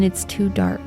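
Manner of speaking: ordinary talk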